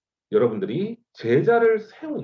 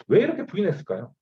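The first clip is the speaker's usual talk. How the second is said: normal talking voice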